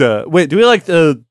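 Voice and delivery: usual speaking voice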